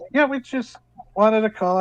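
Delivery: cheerful daughter on the phone; a hint of a teenage girl; daughter's voice. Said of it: "She goes".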